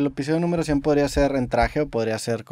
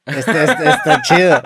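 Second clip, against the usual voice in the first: laughing; 5 dB louder, slower than usual. Real, not acted. Laughs mid-sentence.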